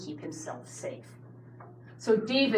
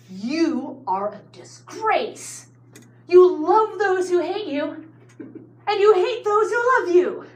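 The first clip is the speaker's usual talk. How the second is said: slow at first, then much higher and louder; angry shout; 10 semitones higher, 8 dB louder, slower than usual. Retold or imitated. Joab berates the weeping David, rising furious shout. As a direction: build from a cold rebuke to a furious shout. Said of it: "starts shouting at him".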